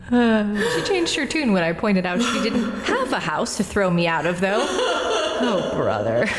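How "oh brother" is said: laughing through the words; amused, shaking head with a laugh; chuckling at the memory. Acted. Laughing over the quarrel.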